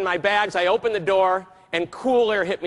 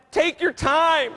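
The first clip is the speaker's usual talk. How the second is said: relaxed; 8 semitones higher, 3 dB louder, much slower than usual. Retold or imitated. Relaxed passenger reply.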